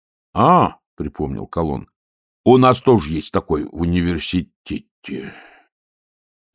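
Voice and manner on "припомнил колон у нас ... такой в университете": usual speaking voice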